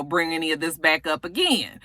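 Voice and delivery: usual speaking voice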